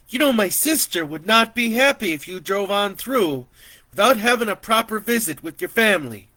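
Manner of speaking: high, loud, pushing relative; insistent auntie. Acted. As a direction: insist he stay for a family meal. Relative pleading, voiced.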